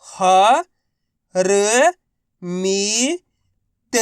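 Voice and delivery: राजनीतिक drawn out one syllable at a time. Shown as a demonstration. Teacher sounding out राजनीतिक for learners. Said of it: "तो बताइए मिलके क्या बनेगा".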